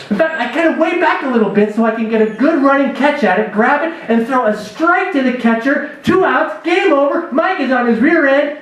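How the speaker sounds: usual speaking voice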